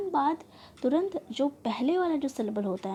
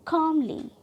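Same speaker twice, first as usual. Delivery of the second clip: "कामली" with काम raised and strong; first-syllable stress; 4 semitones higher, 4 dB louder, slower than usual. Shown as a demonstration. Teacher giving a rule example, काम stressed in "calmly". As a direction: punch काम, drop off on ली in "calmly". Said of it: "हम first syllable पर trust कर रहे हैं".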